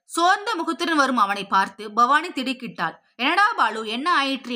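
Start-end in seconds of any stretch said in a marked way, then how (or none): none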